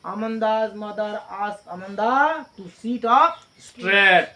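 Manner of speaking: very slow dictated answer; slow dictation voice. Shown as a demonstration. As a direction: read it out slowly for copying. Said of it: "किस तरीके से बैठने के लिए".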